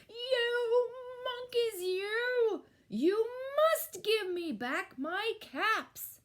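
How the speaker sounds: high, loud, drawn-out furious shout; very angry storybook peddler shouting. A narrator's reading. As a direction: bellow slowly at the monkeys, every word stretched. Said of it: "and he shouted".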